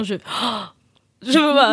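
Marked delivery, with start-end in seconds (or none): 0.2-0.7 sharp mock-horrified intake of breath; horrified gasp. Shown as a demonstration. Speaker showing how much she hates shame, horrified gasp. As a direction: gasp in dread of embarrassment. Acting out her dread of shame.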